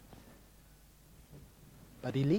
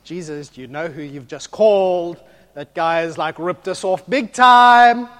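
disciples protest to Jesus, outraged and loud; indignant protest; 14 dB louder than usual. Retold or imitated.

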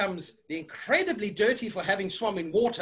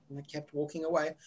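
plain reading voice

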